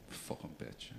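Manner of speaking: whispered curse under the breath; muttered whisper. Retold or imitated. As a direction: mutter it so your partner can't hear. Muttered curse after the offer.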